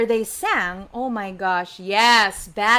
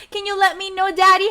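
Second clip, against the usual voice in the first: plain reading voice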